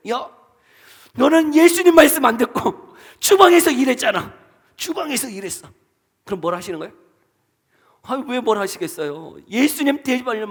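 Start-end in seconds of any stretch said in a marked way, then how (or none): 0.1-5.7 mock scolding.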